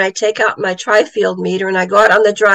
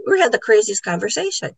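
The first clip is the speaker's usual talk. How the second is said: ordinary delivery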